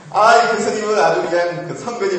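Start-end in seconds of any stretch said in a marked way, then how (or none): none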